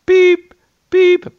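high, loud beep-beep like a truck reversing; playful beeping noise; imitated backing-up beep. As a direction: mimic a reversing alarm. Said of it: "So I did the beep".

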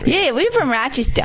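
mock Rochester townsperson accent; local Rochester accent. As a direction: put on a hammed-up Rochester local. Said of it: "we like to say things like Rochester".

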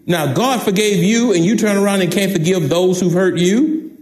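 ordinary delivery

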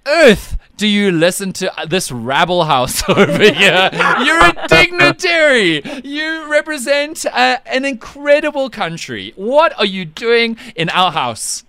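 high, loud, drawn-out incredulity; mock outrage. Host asking a dignitary why he listens, mock-outraged. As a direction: scold an honoured guest in comic disbelief.

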